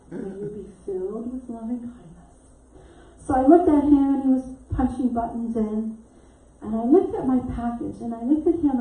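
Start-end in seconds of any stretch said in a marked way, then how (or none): 0.1-2.5 muttered prayer. Retold or imitated. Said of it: "my prayer".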